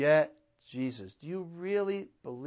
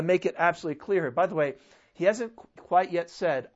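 normal talking voice

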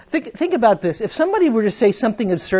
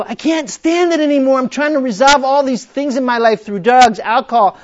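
higher, louder pleading; desperate plea; 7 semitones higher, 8 dB louder than usual. Acted. Addict at rock bottom pleading, higher. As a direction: plead like you've hit bottom. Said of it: "you go".

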